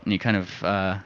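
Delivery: ordinary talk, nothing marked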